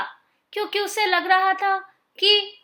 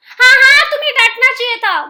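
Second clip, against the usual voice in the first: much louder, higher, rushed yes-yes; hasty agreement; 7 semitones higher, 9 dB louder, faster than usual. Acted. Crocodile hurriedly agreeing with his wife, loud and fast. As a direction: blurt out a quick eager agreement.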